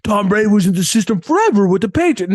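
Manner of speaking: put-on voice of a dismissive critic; mock naysayer. Host parroting critics of Tom Brady's system, lightly mocking naysayer voice. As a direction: mimic the tired argument mockingly. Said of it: "I've heard the same thing about Tom Brady".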